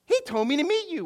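high, loud excited retelling; excited discovery. Retold or imitated. Stranger realizes they share a friend, excited.

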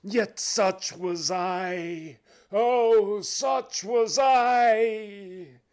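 high, loud ghostly lament; anguished cry. Acted. Phantom bewails its misused life to Scrooge, crying out. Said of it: "cried the phantom".

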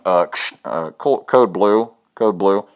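loud, urgent radio call; mock walkie-talkie alert; security guard. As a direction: imitate a guard calling a code over the radio. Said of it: "the security guards were going".

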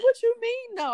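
very high-pitched mimicked disbelief; incredulous. Mama Rita hears she won't call him, incredulous. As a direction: challenge that refusal in a high voice. Repeats retold incredulous challenge.

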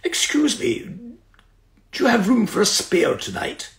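lightly posh, polite request; a hint of a posh gentleman. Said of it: "this very posh voice said".

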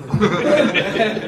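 a short high burst of laughter; real brief laugh. Real, not acted. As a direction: laugh out at the punchline. Laughs at the rabbi's answer.

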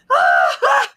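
a high, loud, hammed-up yelp; mock panicked yelp. Imitating Amber caught on the live, mock yelp. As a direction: mimic Amber spotting the camera and panicking. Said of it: "and then was like".

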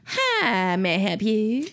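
"may I help you" slurred into a few syllables; syllables squashed together. Retold or imitated. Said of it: "don't have time for all the syllables".

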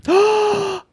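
sharp high gasp of shock. Real, not acted. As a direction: gasp out loud in real surprise. Reacting to the slap story.

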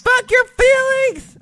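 loud, high, drawn-out shout; full-volume high-pitched holler; defiant yell. Real, not acted. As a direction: yell it out gleefully defiant, stretching the words. Yelling back the co-host's hashtag.

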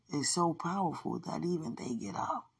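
ordinary delivery

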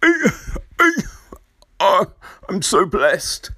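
mock choked-up speech, gasping and halting; fake tears. Imitating an actor thanking his family, fake tearful choking up. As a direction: badly fake being overcome, breaking between phrases. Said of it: "sometimes it's the fake tears".

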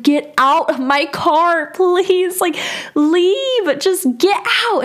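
high, pleading mock yell; retold screaming. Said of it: "I was screaming".

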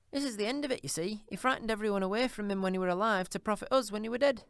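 put-on high, coarse old charwoman, brazen; a bold thieving charwoman, defiant. A narrator's reading. Charwoman gloats over gains.

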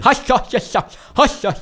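loud, high harsh mock German babble; hammed-up imitation of German talk; mock German gibberish. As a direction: belt out fake German noises. Imitating German speech sounds.

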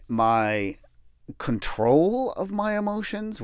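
normal talking voice

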